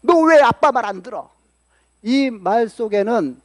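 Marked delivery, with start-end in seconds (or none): 0.0-1.3 higher-pitched mock-angry dad; a touch of fatherly anger; acted parental scolding. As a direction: imitate a father telling off his child. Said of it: "자식들에게 화내는 것도 마찬가지예요".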